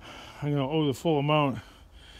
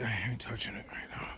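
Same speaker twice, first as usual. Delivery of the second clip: whispering; 4 semitones lower, 11 dB quieter than usual. Real, not acted.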